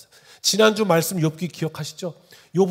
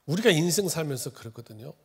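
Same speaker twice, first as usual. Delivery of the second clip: ordinary talk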